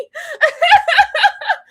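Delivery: high loud laugh; shrieking laugh. Real, not acted. Host laughing at Michelle, shrieking laugh.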